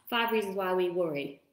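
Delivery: quick tongue-twister run-through; said fast. Shown as a demonstration. Host showing viewers the hard-to-say phrase, said fast. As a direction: rattle it off as a speed test. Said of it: "Try and say that really fast".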